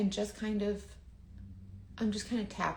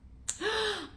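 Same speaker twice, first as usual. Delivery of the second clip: emotional gasp. Real, not acted. Reacting to product, about to cry.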